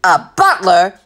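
loud incredulous captain; roaring. A narrator's reading. Captain astonished to hear he has a butler aboard, roaring. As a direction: roar it back in disbelief. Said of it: "the captain roared".

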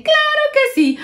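very high, chirpy yes; high little chorus saying yes; squeaky animals agreeing. Acted. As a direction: pipe up like tiny critters. Animals welcome the bear.

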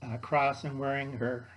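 usual speaking voice

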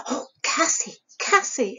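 high, breaking in on her sister; lightly acted girlish eagerness; eager interruption. A narrator's reading.

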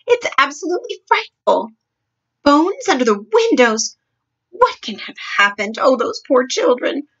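high, appalled exclamations; horrified cry. A narrator's reading. Queen appalled at the missing children, horrified cry. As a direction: exclaim in dismay, pitch jumping up. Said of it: "the famous voice cried out".

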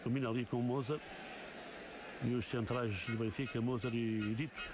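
steady live play-by-play; football commentary. Real, not acted. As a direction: call the match as it unfolds.

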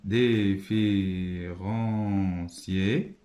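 Différencier drawn out very slowly; very slow spelled word. Shown as a demonstration. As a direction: stretch Différencier syllable by syllable.

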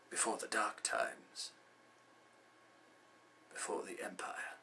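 low, hushed and grave; grave recollection. Acted. Mentor revealing a father's murder, grave and hushed. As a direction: sink into a sombre near-whisper.

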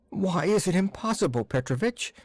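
put-on high, pleading childlike plea; pleading like a child. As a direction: beg like a small child. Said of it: "almost in the pleading voice of a child".

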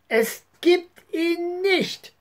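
put-on high, slow cry of a girl; girl crying out in protest; calling out. A narrator's reading. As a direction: call out stubbornly, drawn out. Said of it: "rief Sabine".

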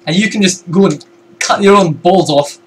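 put-on Scottish voice, lifted in pitch; Scottish accent. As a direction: tell them off in a Scottish accent. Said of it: "Was that a good voice?".

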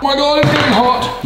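high startled complaint; startled, high-pitched. Real, not acted. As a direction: blurt it out, caught off guard by heat. Handling hot food.

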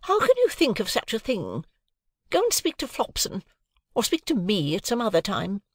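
wife affronted by a maid; roused dignity. A narrator's reading. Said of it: "whose dignity was roused again".

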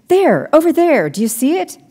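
urgent. A narrator's reading. Maddie showing the men the thing in the water, urgent. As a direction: point and urge them to look.

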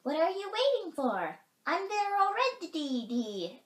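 very high, loud bird exclamation; exclaiming chickadee. A narrator's reading. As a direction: call out impatiently, stretching the last words. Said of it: "exclaimed the chickadee".

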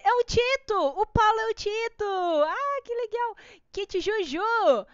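light up at spotting a friend; delighted surprise. Real, not acted. Reconhece um espectador no chat. (surpresa alegre e alta)

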